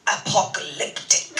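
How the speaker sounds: mild mock Austrian accent; a light Austrian lilt on apocalyptic; Christoph Waltz accent. Christoph reacting to the interview ending, mild mock Austrian accent. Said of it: "perfect the Christoph Waltz accent".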